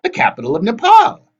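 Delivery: high, loud boast; bragging hag. A narrator's reading. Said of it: "Wasp bragged".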